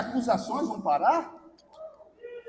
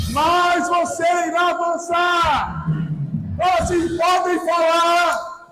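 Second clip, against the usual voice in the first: shouted declarations with drawn-out endings; full-force preaching; 3 semitones higher, slower than usual. Real, not acted. Preacher leading the congregation to declare they will advance, shouted preaching.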